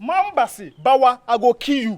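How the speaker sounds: high, loud warning; angry threat. Acted. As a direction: throw the threat back forcefully. Acted quarrel, threatening to kill.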